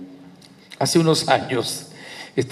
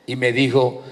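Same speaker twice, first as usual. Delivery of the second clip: ordinary delivery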